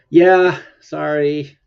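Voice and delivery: talking through a yawn; stifling a big yawn. Real, not acted. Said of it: "Been trying to hide the yawns".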